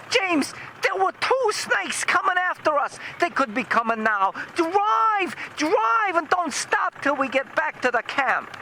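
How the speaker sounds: high falsetto panic; imitated frantic mother, very high; mock hysterical wife. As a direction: shriek orders, hammed-up terror. Retold panicking wife orders driver.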